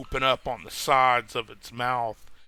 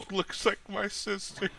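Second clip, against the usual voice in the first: giggling through the joke, pitched higher; laughing; 9 semitones higher, 5 dB quieter than usual. Real, not acted. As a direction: break into laughter mid-line. GM jokes about monster's looks.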